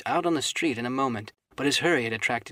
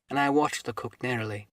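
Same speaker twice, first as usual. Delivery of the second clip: plain reading voice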